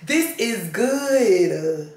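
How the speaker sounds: very slow, stretched-out relish on this is good; drawn-out relish. Real, not acted. The same woman repeating her praise of the passage, very slowly and savoring. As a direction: drag each word out like tasting it.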